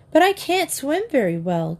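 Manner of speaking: object, pitch lifted in reluctance; worried objection. A narrator's reading. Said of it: "Kitty objected".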